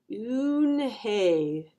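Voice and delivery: "Eunhye" drawn out slowly; slow, careful saying. A narrator's reading. Joey trying his friend's name, slowly and carefully. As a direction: stretch both syllables of "Eunhye" with care. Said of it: "Joey said slowly".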